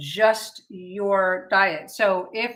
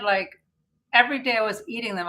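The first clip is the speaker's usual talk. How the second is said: usual speaking voice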